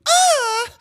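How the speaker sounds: loud high grunt as the ball is hit; tennis grunt. Shown as a demonstration. Player grunts on each tennis hit, loud and high. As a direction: let out a sharp, high grunt. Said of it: "I'm not going".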